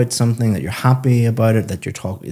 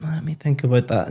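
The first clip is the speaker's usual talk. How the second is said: higher, softer musing voice; thinking aloud; 6 semitones higher, 4 dB quieter than usual. Retold or imitated. Examiner imitating the student forming ideas in part three, musing aloud. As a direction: mull it over in a light, high, softened voice. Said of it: "and pausing a little bit".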